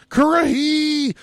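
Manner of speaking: long, high drawn-out yell; shouted battle cry. Retold or imitated. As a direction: bellow it like a drill sergeant. Band of Brothers rallying cry.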